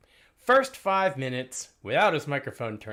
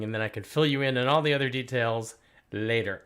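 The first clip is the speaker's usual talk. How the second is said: ordinary delivery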